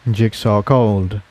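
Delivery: ordinary delivery, nothing marked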